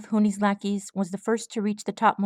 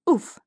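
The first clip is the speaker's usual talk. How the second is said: winded grunt; 7 semitones higher, 4 dB louder than usual. A narrator's reading. Villain hit in gut.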